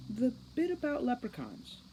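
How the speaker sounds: put-on high, girlish and tentative; hesitant Juliet. A narrator's reading. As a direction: lift the voice like a nervous teen girl. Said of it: "she said hesitantly".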